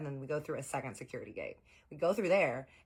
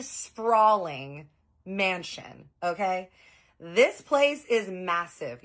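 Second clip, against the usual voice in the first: slow and much louder, each word leaned on; awed emphasis; 4 semitones higher, 9 dB louder, much slower than usual. Real, not acted. Telling viewers how massive the mansion was, slow loud awe. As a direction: stretch it out, amazed.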